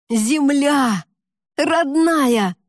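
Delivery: high, loud shout of joy; joyful shout. A narrator's reading. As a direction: yell it out overjoyed. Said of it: "закричал Валерка, от радости". (радостный крик)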